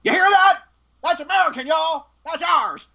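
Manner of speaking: playful high mock boast; imitating a proud actor. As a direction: crow it like a proud American fan.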